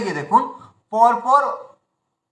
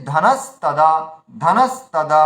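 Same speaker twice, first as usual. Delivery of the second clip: ধানাস held, then lower তাদা after a gap; pause mid-word; 5 semitones lower, slower than usual. Shown as a demonstration. Teacher models the final form for learners, ধানাস তাদা split. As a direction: say ধানাস তাদা in two clear beats. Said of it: "তাহলে আমরা উচ্চারণ করবো কি".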